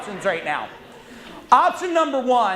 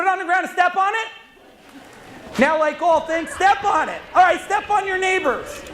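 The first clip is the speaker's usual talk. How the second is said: hyped-up shouting; 6 semitones higher than usual. Real, not acted. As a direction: shout it like a rally cry. Speaker hypes crowd, stepping on paper.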